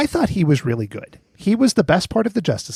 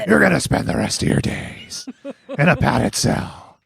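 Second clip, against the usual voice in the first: growled superhero impression, slowing at the end; Batman; slower than usual. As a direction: growl it like a menacing masked vigilante.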